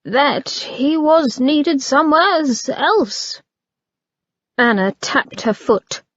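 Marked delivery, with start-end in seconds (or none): 0.0-3.4 careful. A narrator's reading. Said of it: "the valet said carefully".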